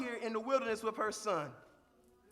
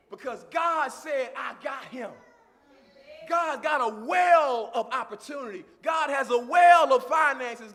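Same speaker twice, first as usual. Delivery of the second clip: full-force preaching; 2 semitones higher, 10 dB louder than usual. Acted. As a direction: preach it at the top of your voice.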